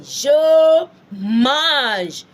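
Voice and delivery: "Je mange" high, loud, each word stretched; long "Je", then long "mange" ending zh; slow emphatic drill. Shown as a demonstration. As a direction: call out "Je mange" for learners to echo. Teacher drilling a French phrase.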